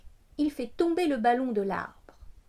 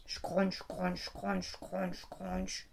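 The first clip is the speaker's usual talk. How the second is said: crunching sounds; 6 semitones lower, 10 dB quieter, much slower than usual. A narrator's reading. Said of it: "mâchonne".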